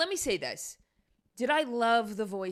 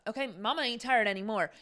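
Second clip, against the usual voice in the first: ordinary delivery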